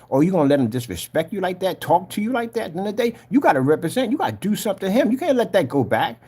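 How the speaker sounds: high, urgent egging-on; goading. Retold or imitated. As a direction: imitate a tempter needling someone to fight back. Acts Satan goading him.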